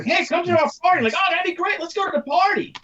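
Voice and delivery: high-pitched, eager party chatter; mock eager invite. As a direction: act out gushing party-goers, pitched well up.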